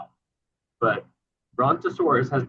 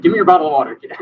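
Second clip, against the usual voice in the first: mock stern order; 5 semitones higher, 12 dB louder, faster than usual. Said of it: "you're like".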